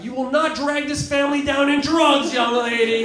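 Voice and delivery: high, loud scolding mother; angry mom. Retold or imitated. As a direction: scold her like a furious mom. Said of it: "An exact quote, she's like".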